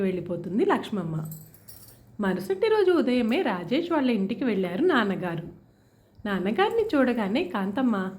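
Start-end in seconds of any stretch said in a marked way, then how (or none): none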